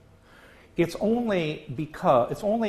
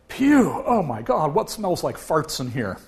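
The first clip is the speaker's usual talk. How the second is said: disgusted driver; 3 semitones higher, 4 dB louder than usual. Acted. Cartoon man smells cheese.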